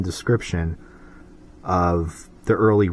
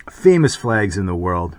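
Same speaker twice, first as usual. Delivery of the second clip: ordinary talk, nothing marked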